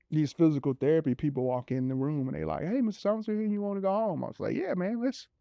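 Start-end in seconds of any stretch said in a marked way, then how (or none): 2.6-4.2 lightly voiced, higher upbeat therapists; a hint of a cheery caregiver, pitched up. Therapists voiced in retold story. 4.6-5.3 eager yeah. Retold or imitated. Eager reply retold.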